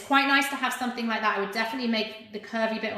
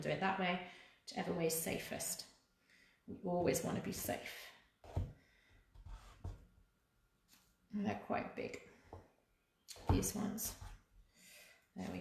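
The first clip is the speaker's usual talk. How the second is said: muttered to self; 11 dB quieter than usual. Real, not acted. Muttering to herself while threading.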